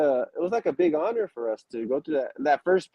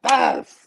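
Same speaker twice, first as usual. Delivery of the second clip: slap sound; 5 dB louder than usual. Retold or imitated. Imitating landing impact.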